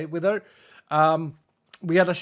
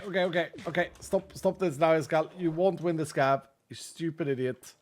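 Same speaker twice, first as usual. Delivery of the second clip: ordinary delivery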